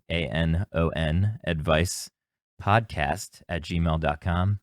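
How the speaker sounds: spelled out slowly. Real, not acted. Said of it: "spelling out the email".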